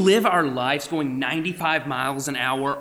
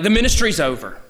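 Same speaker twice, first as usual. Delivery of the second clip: mock despair; 6 semitones higher, 7 dB louder than usual. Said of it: "And then you're like".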